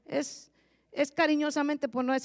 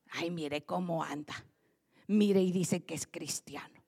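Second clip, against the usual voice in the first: gossiping whisper; 6 semitones lower, 5 dB quieter than usual. Retold or imitated. Gossiping neighbours mocking believer.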